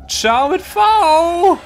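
very high, slow sing-song goodbye; silly high drawn-out voice. Pretend. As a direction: stretch it out in a silly squeal.

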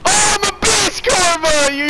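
high triumphant yell; excited shout. Real, not acted. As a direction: scream it out in triumph. Celebrating a goal, shouting.

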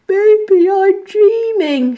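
high, slow yawning voice; sleepy monkey. A narrator's reading. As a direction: drawl it sleepily.

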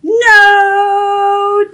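high, drawn-out cry of dismay; loud wail. Acted. Lost duck finds no duck friends, loud wail. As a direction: wail it out for the whole city to hear. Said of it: "He's loud enough the whole city heard him".

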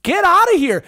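high, loud comic tirade; mock outraged shouting. Comedian ridiculing small-town tough guys, high outraged shout. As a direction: yell them down in comic disbelief. Shouts down absent would-be gangsters.

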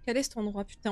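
normal talking voice

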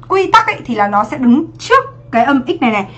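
normal talking voice

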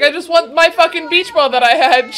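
loud mock tantrum; mock upset kid. Reactor voicing the kid's beach ball plea, mock tantrum. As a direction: lightly imitate a kid demanding it back. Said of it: "It's like".

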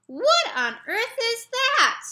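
a woken dad demanding what the noise is; startled exclamation. Acted. Dad startled awake in Jeremy's bed, loud astonished cry.

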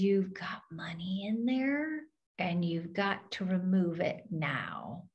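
soft, hushed whisper passing on a secret; leaning in to whisper the spirit's warning. Retold or imitated. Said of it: "You need to whisper this".

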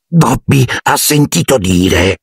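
high, slow elf voice; elfo dalla voce roca. A narrator's reading. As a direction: draw it out as a hoarse little elf. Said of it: "disse con voce roca". (voce roca da elfo, acuta e lenta)

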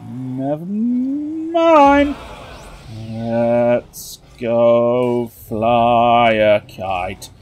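slow drawl. Pretend.

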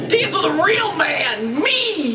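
slow, high-pitched parody boast; hammed-up boast. Reader mocks Donald's line between laughs, hammed-up boast. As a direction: overblown hero, soaring on the last word.

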